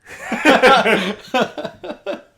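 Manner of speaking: a loud, high burst of laughter. Real, not acted. Friend insists there's time, big loud laugh. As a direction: laugh it off before the long story. Asked to tell long story.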